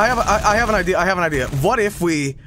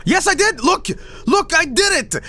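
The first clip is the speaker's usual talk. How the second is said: triumphant shout; 12 semitones higher than usual. Real, not acted. Streamer shouting to chat he fixed it, triumphant. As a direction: shout it out, thrilled you pulled it off. Triumphant after fixing the game.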